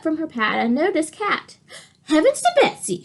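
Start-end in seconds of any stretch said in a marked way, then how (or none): 2.0-3.0 much higher, startled waitress; exclaiming. Acted. The waitress spots the cat, high startled exclaim. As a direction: blurt it out in shock. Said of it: "she exclaimed".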